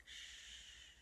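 soft unvoiced shush. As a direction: hush them with a shh. Secret hiding place.

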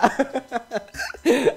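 short high laugh; a brief giggling laugh; real laugh. Real, not acted. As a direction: laugh again at the joke. Laughing again.

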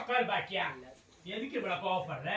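hushed, soothing appeal; calm them down softly. Acted. Telling angry Bakkiya to sit down, softly calming.

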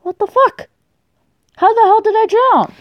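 very high squawk of disbelief; incredulous. Real, not acted. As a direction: protest an absurd death in a high pitch. Disbelief at dying in game.

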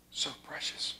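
barely audible whisper; hushed whispered refrain. Real, not acted. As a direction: breathe it out. Whispered refrain after scripture.

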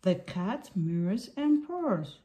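usual speaking voice

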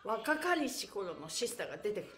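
ordinary delivery

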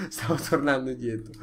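usual speaking voice

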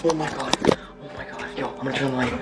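breathless, half-whispered panic; shaking with fear. Real, not acted. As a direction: keep gasping it out, badly shaken. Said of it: "I'm shaking so much".

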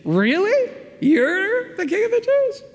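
very high, slow disbelief; high, drawn-out mock astonishment; incredulous. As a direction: scoff in pure disbelief. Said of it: "he's incredulous".

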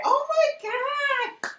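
very high, drawn-out mock wail; imitated assistant's yell. Assistant exasperated at how her boss works, hammed-up yelling. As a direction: wail it loud and slow, hammed-up. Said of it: "yelling at me every day".

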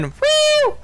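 high falsetto "woo"; high-pitched cheer; excited whoop. Real, not acted. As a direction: squeal a celebratory whoop. Whooping for the win.

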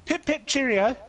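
hammed-up posh British accent, high; mock British accent. Teasing his friends in a mock British accent. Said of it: "You do say mate".

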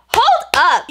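a high, loud, sharp Hold up; indignant hold up. Retold or imitated. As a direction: stop him cold with a high, loud Hold up. Retold outrage at rude guy.

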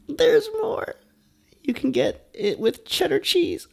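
very high-pitched, squeaky excitement; giddy big reveal. Pretend. Vlogger revealing the cheddar option, giddy high squeal. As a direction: squeal it like a thrilling bonus offer.

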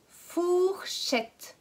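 Fourchette said slowly and clearly; slow clear modelling. Shown as a demonstration. Teacher models Fourchette for the class. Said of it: "Est-ce que dans le mot fourchette".

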